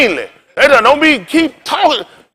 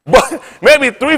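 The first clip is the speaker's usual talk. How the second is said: normal talking voice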